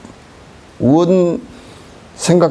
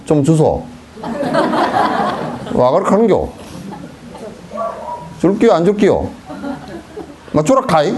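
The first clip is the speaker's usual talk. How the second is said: blunt Gyeongsang beggar; 3 semitones higher than usual. Retold or imitated. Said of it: "경상도 품바는 잘 못 얻어먹어".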